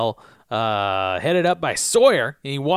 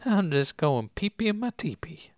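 higher, whispery spaced-out voice; silly hermit voice; 4 semitones higher, 4 dB quieter than usual. Acted. Recapper joking as the teepee hermit, silly and whispery. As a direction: murmur it like a dazed old hippie.

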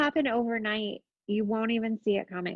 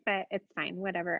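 ordinary talk, nothing marked

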